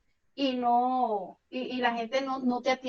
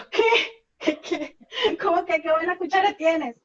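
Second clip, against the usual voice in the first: a sharp, louder, higher echo of his words in mock outrage; baffled disbelief; 4 semitones higher, 4 dB louder than usual. Retelling her confused reaction to a coworker, baffled and louder. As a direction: retell it as if the phrase makes no sense.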